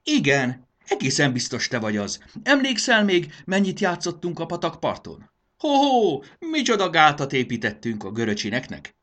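high, loud, excited reminiscing; exuberant, swooping voice; delighted recognition. A narrator's reading. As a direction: greet a long-lost playmate with glee. Delighted cousin recalls shared childhood games.

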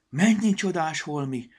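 light up with delight at the pretty clothes; marveling. A narrator's reading. The goblin marvels at the wardrobe, high excited wonder. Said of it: "ámuldozott".

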